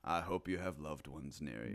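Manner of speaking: a hushed, solemn movie narrator line; Morgan Freeman. Pretend. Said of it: "Morgan Freeman coaching you through".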